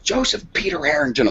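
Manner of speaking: mother's warning, played in imitation; imitated stern grandmother. Grandmother scolds with full name.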